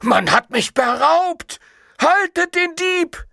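very high, drawn-out cry of alarm; slow, high-pitched frantic call; horrified cry. A narrator's reading. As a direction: cry out that you've been robbed. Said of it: "entsetzten Schrei".

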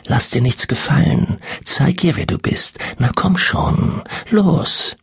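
high, breathy goading whisper; luring spirit whisper. A narrator's reading. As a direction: egg her on in a rising whisper. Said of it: "flüsterte die Stimme in ihrem Hinterkopf".

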